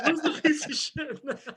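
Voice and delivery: giggling through the question; laughing quote. Retold or imitated. Guest laughing at his own mix-up. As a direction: laugh it out while asking. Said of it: "I was like".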